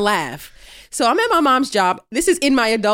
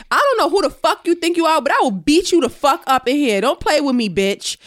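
higher and louder threat; angry threat; 6 semitones higher, 4 dB louder than usual. Retold or imitated. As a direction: square up and threaten her. Threatening the lady at clinic.

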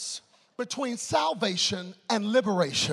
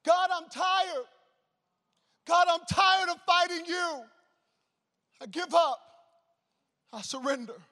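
imitated desperate scream; 8 semitones higher than usual. Young man at the altar giving up to God, imitated high scream. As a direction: cry it out high, easing off into surrender. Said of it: "he starts screaming at the top of his lungs".

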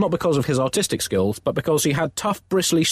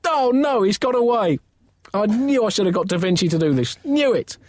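outraged; 10 semitones higher than usual. Acted. Pope outraged at portrait.

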